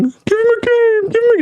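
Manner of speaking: a silly high voice mocking the fans; mock fan plea. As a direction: mimic the fans begging for a game. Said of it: "all the people saying".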